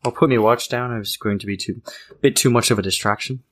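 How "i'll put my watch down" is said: normal talking voice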